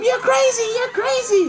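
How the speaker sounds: very high screechy imitation; mock hysterical wife. Retelling the wife slapping her husband, hysterical high mock cry. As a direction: squeal it like a frantic wife. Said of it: "his wife is, like, slapping him".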